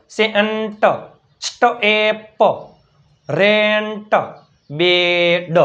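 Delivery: સેન્ટ, સ્ટેપ, રેન્ટ, બેડ stretched, last consonant set apart; blending sounds. Shown as a demonstration. Teacher showing two letters joining, સેન્ટ stretched sound by sound. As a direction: hold the middle of સેન્ટ and બેડ, then release the ending. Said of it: "બે ભેગું થઈ જાય".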